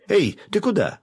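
высоко и громко вслед убегающему; кричит вслед убегающему парню; крик вслед. A narrator's reading. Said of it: "крикнула ему вслед Василиса". (громкий крик вслед)

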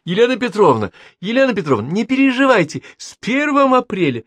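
put-on high, teasing young woman; a student revealing the April Fools' prank; young woman's voice. A narrator's reading. Student reveals the prank to her professor, high and teasing. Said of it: "молодой женский голос произнёс".

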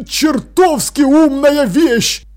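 loud, high, drawn-out praise; exclaiming. A narrator's reading. King slaps apprentice on the back, exclaiming. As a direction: burst out with delighted approval. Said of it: "воскликнул он".

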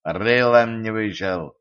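slow, deliberate snub; curt put-down. A narrator's reading. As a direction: put a friend in their place, slowly. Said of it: "осадил друга Тен". (осаживая друга)

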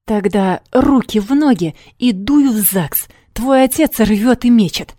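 loud, bossy command; angry order. A narrator's reading. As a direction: order her back, loud and hard. Fiancé ordering her back.

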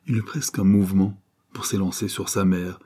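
ordinary delivery

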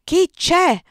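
high, loud yell; yelled challenge. A narrator's reading. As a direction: yell down at whoever is out there. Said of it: "urlò Stefano". (urlato forte)

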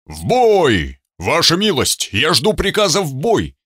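high, ringing voice calling for battle; a hint of a metallic, eager warrior; booming metallic robot voice. A narrator's reading. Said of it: "металлический голос Ульмана".

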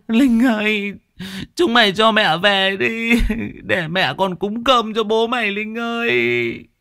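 put-on high, trembling lament of a grieving old woman; tearful old mother. Grieving old mother begs her daughter-in-law to go home. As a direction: plead through tears, drawing out the calls.

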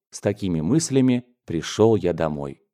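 plain reading voice

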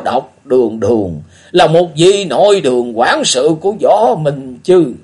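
put-on high, loud, slow old man's voice; a lightly muttered reckoning, still loud; old beggar thinking aloud; old beggar talking to himself. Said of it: "nói như để cho mình nghe".

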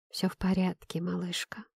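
soft, hushed fatherly comfort; whispered reassurance. A narrator's reading. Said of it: "прошептал папа". (успокаивающий тихий шёпот)

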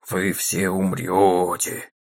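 low, slow graveyard croak; хриплый замогильный голос. A narrator's reading. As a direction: croak it like a ghost story doom. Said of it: "хриплым замогильным голосом произнёс он". (медленно, хрипло и замогильно)

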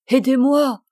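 loud, acted cry for help. A narrator's reading. Dying man to his priest, crying out for help. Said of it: "cria-t-il".

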